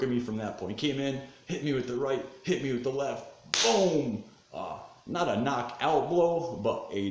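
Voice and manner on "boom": loud, high exclaimed boom; booming punch impact. Retold or imitated. Telling how the punch landed, loud exclamation. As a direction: punch the word out like a hit landing.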